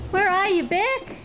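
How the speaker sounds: calling across the shop, high. Real, not acted. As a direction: sing out a name to find someone.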